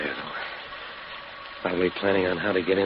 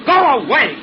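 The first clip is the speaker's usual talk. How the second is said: very high, loud yell; shouting; 9 dB louder than usual. Acted. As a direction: scream her off. Husband yells at woman.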